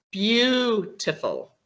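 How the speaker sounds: "Beautiful" with the first syllable drawn out long; long stressed vowel. Shown as a demonstration. Teacher shows students a longer vowel, "Beautiful" with a long first syllable. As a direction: stretch the "Beau" in "Beautiful", slowly. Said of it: "You produce a longer vowel".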